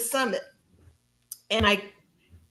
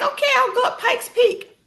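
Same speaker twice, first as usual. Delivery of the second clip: laughing agreement; 14 semitones higher, 3 dB louder than usual. Retold or imitated. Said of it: "And I'm like".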